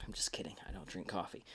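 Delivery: whisper. Real, not acted. Host whispers a joke to listeners, quick and hushed. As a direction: toss it off as a hushed aside. Joking aside about wanting coffee.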